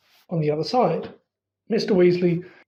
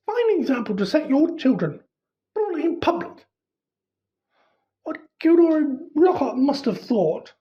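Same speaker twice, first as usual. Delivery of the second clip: scolding mother; 8 semitones higher than usual. Acted. Mother scolding after the brawl.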